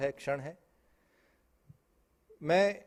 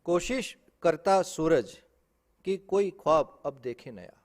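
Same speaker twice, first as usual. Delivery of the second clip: raised, deliberate verse delivery; poetry recitation; 3 semitones higher, 5 dB louder than usual. Acted. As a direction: declaim it slowly and firmly to the hall. Recites poem before aside. (मंच पर बुलंद आवाज़ में काव्य पाठ)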